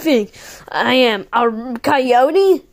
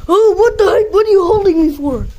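louder, higher startled shout; startled exclamation; 4 semitones higher, 4 dB louder than usual. Pretend. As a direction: jump at being grabbed. Repeated louder after being grabbed.

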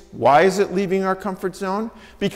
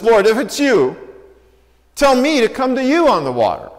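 raised, higher call to Jesus; calling out across water; 5 semitones higher, 5 dB louder than usual. Acted. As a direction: shout it out to someone on the water.

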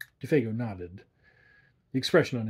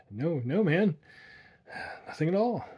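half angry; 6 semitones higher than usual. A narrator's reading. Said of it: "he replied aggressively, half angrily".

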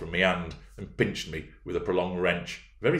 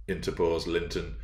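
ordinary delivery, nothing marked

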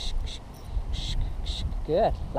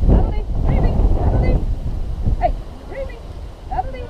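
calling dogs; 14 semitones higher, faster than usual. Real, not acted.

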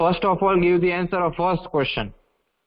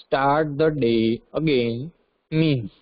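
drawn-out words with gaps between phrases; slow dictation; 2 semitones lower, much slower than usual. Real, not acted. Teacher giving students the next question, slow dictation. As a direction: read the question out slowly for writing.

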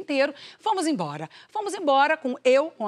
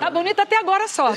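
falando entre risadas; rindo; 6 semitones higher, 4 dB louder than usual. Real, not acted. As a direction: conta rindo da ironia. Rindo da história. (fala rindo)

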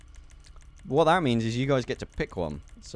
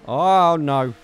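loud, higher "oh no"; dismayed cry; 4 semitones higher, 10 dB louder than usual. Real, not acted. Gamer failing a stunt, loud dismayed cry. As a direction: cry out as it all goes wrong.

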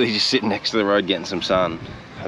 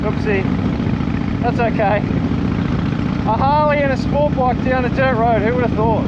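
high, slow, raised voice over the wind; shouting while riding; slower than usual. Real, not acted. Riding down a dirt road, shouting over the wind. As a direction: push the words over engine roar.

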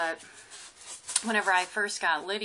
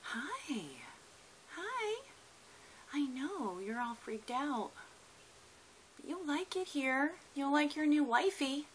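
pet talk; 7 semitones higher, 4 dB quieter than usual. Real, not acted. Soothing the lizard.

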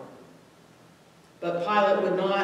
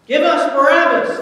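much higher and louder shout; crowd shout; 7 semitones higher, 11 dB louder than usual. A narrator's reading. Crowd shouting their choice to Pilate, full shout. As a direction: bellow it like an angry mob. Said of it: "the crowd shouted".

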